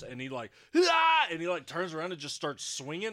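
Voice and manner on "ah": loud, high startled shout; startled yell. Retold or imitated. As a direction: imitate a blinded man lashing out in alarm. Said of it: "it was terrifying when he got blind".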